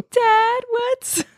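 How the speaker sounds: put-on high whiny child asking; kid voice. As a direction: play a curious kid in backseat. Mimicking kid in backseat.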